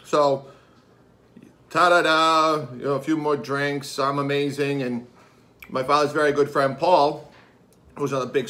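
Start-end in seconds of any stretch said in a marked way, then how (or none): none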